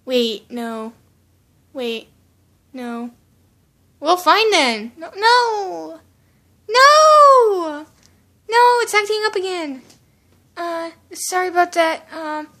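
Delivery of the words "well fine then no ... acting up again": loud, exasperated cries of no; frustrated outburst. Real, not acted. YouTuber whose video acts up again, frustrated outburst. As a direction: protest loudly, pitch jumping up on the drawn-out no.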